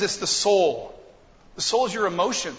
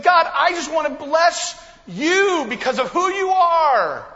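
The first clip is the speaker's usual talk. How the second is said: heartfelt prayer; 9 semitones higher, 6 dB louder than usual. Retold or imitated.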